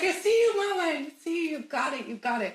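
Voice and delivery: high, excited pet voice easing down; excited dog. Retold or imitated. Dog cheering on her owner, excitedly. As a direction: play a dog bursting with excitement. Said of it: "she just got so excited".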